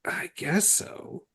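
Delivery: breathy whispered reply. Real, not acted. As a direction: whisper it, slow. Whispered aside after question.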